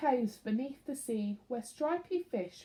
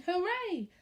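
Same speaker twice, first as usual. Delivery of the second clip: higher cheering villagers; a villager's happy cheer; 7 semitones higher than usual. A narrator's reading. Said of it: "villagers shouting".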